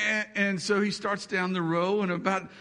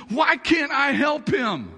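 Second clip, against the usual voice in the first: pleading cry; 9 semitones higher, 6 dB louder than usual. Retold or imitated. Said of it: "And Susan says".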